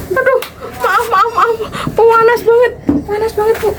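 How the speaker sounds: high-pitched pained yelp. Real, not acted. Hands burning on hot pan.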